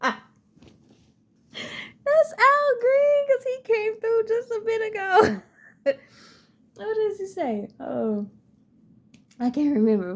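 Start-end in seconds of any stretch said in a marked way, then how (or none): 2.3-5.4 high, fast, laughing delight; laughing at the coincidence, thrilled; laughing excitedly. Real, not acted. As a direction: giggle through it, thrilled.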